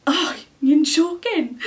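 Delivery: imitating a tearful dad; choked-up dad. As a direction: say it like a dad welling up. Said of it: "he just started, like, filling up".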